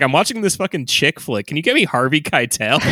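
hammed-up, urgent request; Tarantino. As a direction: play an eager director pestering his agent. Acting Tarantino phoning his agent.

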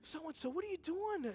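high-pitched voicing of horrified girls; imitated shock. Voicing girls shocked at waitress's arms.

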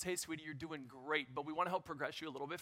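soft, quick, sweet-talking nurse. Retold or imitated. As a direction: coax her gently and quickly like a kind nurse. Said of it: "She goes".

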